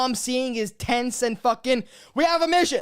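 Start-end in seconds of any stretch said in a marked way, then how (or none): 2.1-2.8 high, louder military-film line; mock movie soldier. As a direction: send up a soldier announcing the mission. Mocking military movie dialogue.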